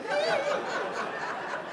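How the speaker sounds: high, soft laugh; a quiet high giggle. Real, not acted. Laughs at widow's reply.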